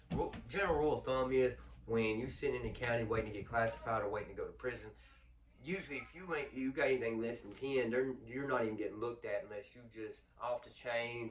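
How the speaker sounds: ordinary delivery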